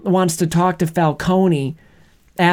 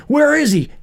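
Batman voice; 10 semitones higher than usual. Retold or imitated. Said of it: "you hear him talk in the Bat voice".

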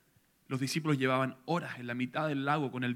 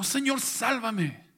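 higher, louder, slow cry for help; a drowning man crying out slowly, higher and louder; desperate cry; 10 semitones higher, 7 dB louder, much slower than usual. Acted. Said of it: "gritó".

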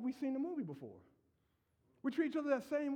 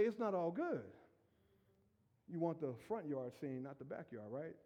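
normal talking voice

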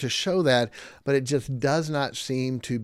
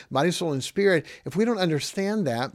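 normal talking voice

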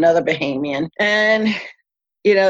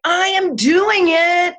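much higher, slow and insistent; exasperated retort; 11 semitones higher, slower than usual. Retold or imitated. Snapping back at the red-faced captain, slow and high-pitched. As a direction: drag it out, fed up.

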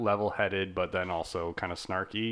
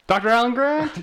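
loud, high-pitched exclaimed question; excited outburst; 14 semitones higher, 10 dB louder than usual. Real, not acted. Co-host reacting to hearing Doctor Alan Grant, excited outburst. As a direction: cry out the name in excited disbelief. Mock outburst at the character's name.

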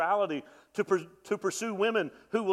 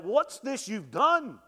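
slowed, higher, louder complaint to his uncle; indignant Jacob; 6 semitones higher, 4 dB louder than usual. Retold or imitated. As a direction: protest the cheat to Laban. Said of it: "and is like".

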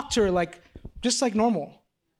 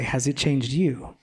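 voice of the Spirit; 6 semitones lower, slower than usual. Retold or imitated. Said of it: "I felt the Spirit say".

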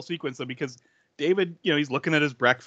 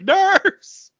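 high, loud call for the nurse; retold shout; 8 dB louder than usual. David calls for the nurse, shouting high and loud. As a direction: yell for the nurse in a panic. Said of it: "He's like".

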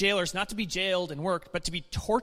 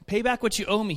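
louder, higher read-aloud demand; 5 semitones higher, 6 dB louder than usual. A narrator's reading. Servant demands payment from fellow servant, demanding. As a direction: read it as a firm, raised demand. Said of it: "he demanded".

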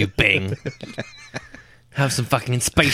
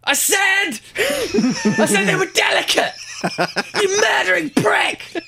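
furious shrieking. Acted. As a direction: shriek it in outraged fury. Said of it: "adult character go".

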